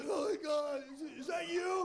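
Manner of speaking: high, loud starstruck gasp; startled clerk. Retold or imitated. Terrified store clerk hearing Joe Pesci calling, high startled shout. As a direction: shriek like you can't believe it's him.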